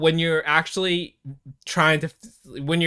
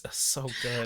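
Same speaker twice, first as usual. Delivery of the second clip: ordinary delivery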